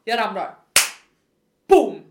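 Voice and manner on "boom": very loud crash word; sudden loud boom. Retold or imitated. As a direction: punch it out like an impact. Spelar upp fallet i asfalten. (plötslig, kraftig smäll)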